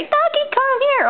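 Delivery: put-on high, sing-song call for the toddler to copy; high sing-song prompt. Shown as a demonstration. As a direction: model it high and bright for a baby.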